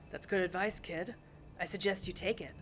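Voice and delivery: lightly voiced tough detective; a hint of hard-boiled menace. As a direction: play a laid-back noir heavy, lightly.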